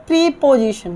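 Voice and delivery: "प्रीपोजिशन" said pree-position; long EE at the start of "प्रीपोजिशन"; students' mispronunciation. Shown as a demonstration. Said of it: "विद्यार्थी बोलते हैं". ("प्रीपोजिशन" को प्री-पोजिशन बोलते हुए)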